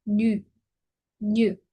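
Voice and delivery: Ñu with lips rounded over an I sound; rounded vowel. Shown as a demonstration. Teacher models woman character, Ñu with rounded I sound. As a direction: round the lips hard on Ñu, voicing I. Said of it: "es como U súper redondeado".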